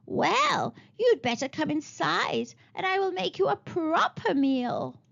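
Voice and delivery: put-on high, falsely kind old witch; sweet old witch voice. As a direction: coax them in like a sly hag.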